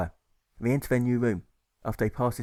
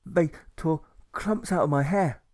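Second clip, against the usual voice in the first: nervous engineer; 6 semitones higher, slower than usual. Acted. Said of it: "the engineer adds with his nervous grin".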